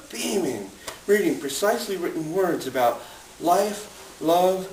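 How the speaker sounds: rhythmic spoken-word storytelling; poem reading. Acted. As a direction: perform it like a beat poet in a coffee shop. Reciting vaudeville poem.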